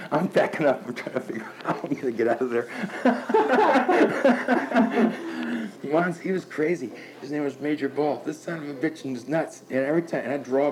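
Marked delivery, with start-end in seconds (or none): 2.7-5.8 hearty high laugh. Real, not acted. Laughing at own war story.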